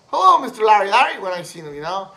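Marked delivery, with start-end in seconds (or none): 0.1-1.2 high, loud, fast sing-song hello; a teasing kid greeting the teacher; mocking greeting. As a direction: bust his balls with a cheery hello. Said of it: "just to bust his fucking balls".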